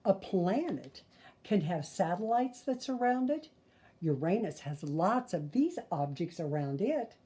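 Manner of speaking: put-on lively, sing-song read-aloud for kids; storyteller voice for little listeners; reading to children. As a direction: read the rhymes like a bedtime picture book. Reads picture book to children.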